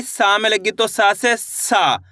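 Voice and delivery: "सा" said with a drawn-out long aa, slowly; stretched vowel. Shown as a demonstration. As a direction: stretch the aa in "सा" clearly. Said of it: "इसको अपन खींच के पढ़ देंगे सा".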